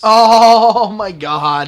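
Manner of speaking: loud, drawn-out slow gasp of disbelief; loud slow stunned exclamation; shocked outburst. Real, not acted. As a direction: blurt it out, stunned. Sudden shock at hidden map.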